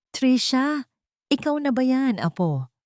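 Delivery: an old mechanic lightly voiced, surprised to see her; garalgal sa gulat. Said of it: "gumaralgal sa gulat". (bahagyang garalgal na boses sa gulat)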